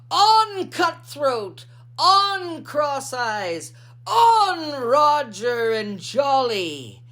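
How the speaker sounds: high, loud hearty captain's call to the seahorses; rally the seahorses by name like a rowdy captain; pirate calling his team. Acted. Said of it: "called 'em by name".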